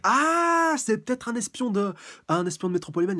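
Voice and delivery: high, loud, rushing excitement; excited realization. Real, not acted. Reader realizing there is a spy, high and loud with excitement. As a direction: blurt it out as the idea hits.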